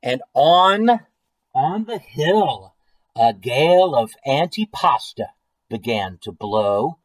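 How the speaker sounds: slow, theatrical read-aloud; story narration. A narrator's reading. Reading of a food storm on the hill, story narration. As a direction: build the suspense of a gathering storm. Reads a picture book aloud.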